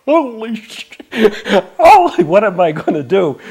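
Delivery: shocked. Real, not acted. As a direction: blurt it in sudden panic. Shocked reaction to guru's order.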